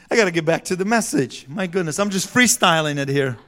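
ordinary talk, nothing marked

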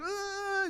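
long high-pitched wail, kept soft; mock screaming cry. Boy crying at his mom, high mock wail. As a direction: imitate a child's hysterical bawl. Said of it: "screaming, crying".

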